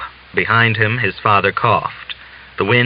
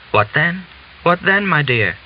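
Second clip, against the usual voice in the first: much higher, puzzled asking; startled question; 7 semitones higher than usual. Acted. Father-in-law puzzled by Natalia's plea, much higher. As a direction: ask in surprise. Old man surprised, questioning.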